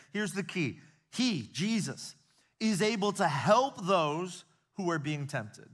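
building preacher's cry over the congregation; fervent preaching. Acted. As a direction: preach it out with conviction. Preaching at full force.

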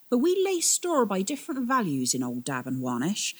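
a hint of a weathered boatman holding forth; old sailor. Old captain lectures the twins on duty, rustic and earnest.

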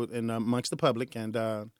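usual speaking voice

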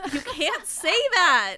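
loud, slow, high protest; loud scolding. Real, not acted. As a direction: scold her loudly. Scolding yeet.

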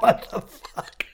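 breathless, whispered laugh through the words; can barely get it out for laughing; giggling incredulous whisper; laughing disbelief. Retold or imitated. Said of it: "I'm like".

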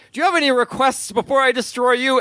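lightly hammed-up villain taunt; menacing monster. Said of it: "the monster says".

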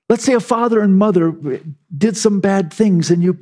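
ordinary delivery

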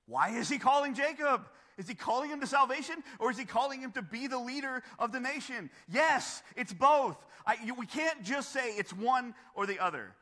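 high, loud rhetorical questioning; impassioned preaching. Real, not acted. Preacher pressing congregation on why God calls Jacob, impassioned.

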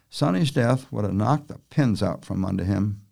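plain reading voice

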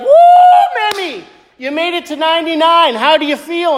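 high, cheery birthday hello; excited greeting. Retold or imitated. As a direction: greet her with a big whoop. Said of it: "be like".

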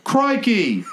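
high, hammed-up Aussie exclamation; Australian accent. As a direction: attempt British, come out Australian. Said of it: "That's Australian".

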